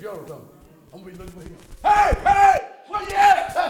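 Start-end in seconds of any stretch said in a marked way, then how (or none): 1.8-3.6 loud, high shout across heaven; calling out. Pretend. Preacher hollering for the Gadarene in heaven, loud and high. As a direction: holler to find a man in a crowd.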